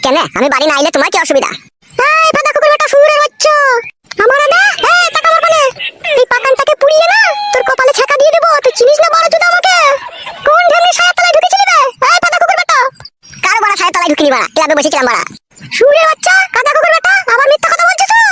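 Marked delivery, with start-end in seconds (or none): 1.9-12.8 high-pitched furious wife; angry threatening. Acted. Wife threatens to burn husband's forehead, angry high-pitched abuse. 15.8-18.2 high, shouted insults; angry accusing. Acted. Wife accuses husband of lying, angry high shouting.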